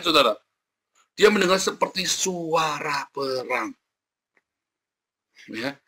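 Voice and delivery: ordinary delivery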